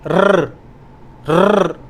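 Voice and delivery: रर as a long rolling trill. Shown as a demonstration. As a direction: roll रर with the tongue tip. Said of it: "आर को खींचते हैं".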